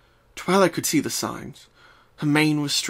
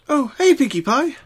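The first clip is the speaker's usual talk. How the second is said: put-on higher, bright young mare voice; Twilight voice; 13 semitones higher, 6 dB louder than usual. Twilight opens the door to Pinkie, higher friendly voice. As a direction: voice Twilight greeting her friend.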